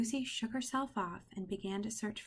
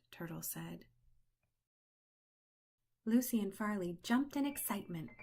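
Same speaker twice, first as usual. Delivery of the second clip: ordinary delivery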